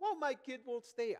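complaining parent. Retold or imitated.

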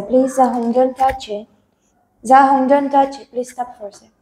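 normal talking voice

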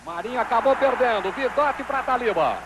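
normal talking voice

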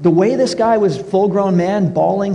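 usual speaking voice

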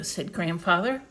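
ordinary delivery

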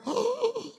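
high, drawn-out "ooh"; shocked gasp. Retold or imitated. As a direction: let out a high hoot of astonishment. Shocked reaction at being exposed.

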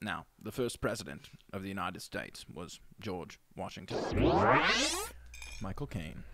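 a hushed Michael Caine history lesson; Michael Caine impression. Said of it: "[name] will be impersonating Michael Caine".

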